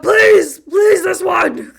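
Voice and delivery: high, loud, drawn-out pleading shout; loud mock begging. Joining in begging for this one, loud shouted plea. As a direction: plead and drag out the last please. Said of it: "[name] is begging".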